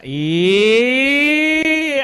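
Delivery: long, loud rising "eee"; playful drawn-out squeal. Pretend. Seminar speaker teasing the audience, loud rising squeal. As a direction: hold a teasing squeal and let it climb.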